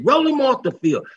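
loud, high call to clear the field; yelling to roll the player off; retold shout. Teammates calling out after a hit, a loud high yell.

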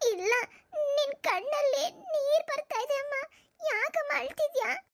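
put-on high, anxious child asking why mum cries; a small daughter pleading with her tearful mother; worried little girl. Daughter asks crying mother why, worried high child voice.

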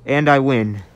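loud, proud cheer of victory; triumphant. Real, not acted. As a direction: announce the win with joy. Wins the hiding game.

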